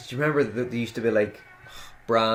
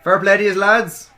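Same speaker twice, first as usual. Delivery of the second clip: high, loud cheer to the ring; calling out; 9 semitones higher, 10 dB louder than usual. Real, not acted. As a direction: shout praise across the arena. Calling out to the wrestlers.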